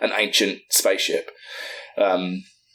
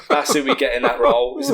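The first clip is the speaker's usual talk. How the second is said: laughing; 13 semitones higher than usual. Real, not acted. Pitching John Oliver for the role, laughing. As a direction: laugh while pitching the casting.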